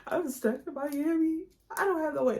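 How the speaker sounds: high, loud panicked cry; a mock frantic wail of being stranded. Retelling being stranded to viewers, high panicked wail.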